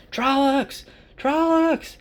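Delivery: high-pitched mock alarm cry; cry from outside. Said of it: "there's a cry from outside".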